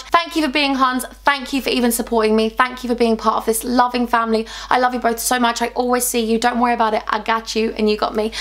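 ordinary talk

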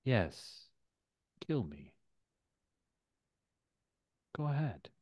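soft, unhurried dare with long pauses; quiet villain taunt. Acted. Villain in the friend's body invites the blow, quiet taunt. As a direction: goad them softly, each phrase falling away.

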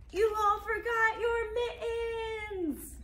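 high, very slow wailing complaint; roaring grumble. Acted. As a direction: drag it out in mock despair. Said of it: "mumbled and grumbled and rumbled and roared".

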